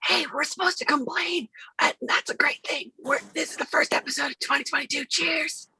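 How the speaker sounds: unvoiced fast whisper. Shown as a demonstration. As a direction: whisper it quickly. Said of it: "A S M R".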